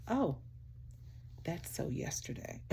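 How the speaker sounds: hushed, breathy brush-off of a Californian friend; barely voiced mock dismissal; mock California friend. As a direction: toss it off like a trend-chasing friend, under your breath. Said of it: "So I love about my friends in California".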